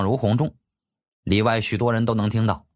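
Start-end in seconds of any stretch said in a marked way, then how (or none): none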